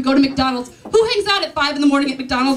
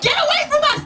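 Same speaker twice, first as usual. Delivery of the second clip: much higher yell through laughter; 12 semitones higher, faster than usual. Retold or imitated. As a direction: shriek at the attacker, half laughing. Fighting off the man.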